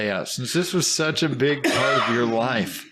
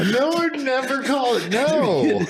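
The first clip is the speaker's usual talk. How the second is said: shocked; 13 semitones higher than usual. Real, not acted. Shocked at the phrase.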